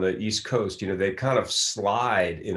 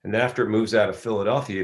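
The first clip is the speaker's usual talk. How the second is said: usual speaking voice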